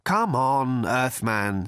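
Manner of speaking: mildly nervous urging; a touch of anxiety. A narrator's reading. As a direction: urge someone away, uneasily. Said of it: "said Slarty Bartfast nervously".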